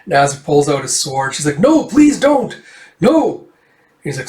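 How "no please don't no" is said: high, loud mock pleading; alarmed plea. Woman pleads as he pulls out his sword, alarmed. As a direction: act out a frightened, high-pitched plea.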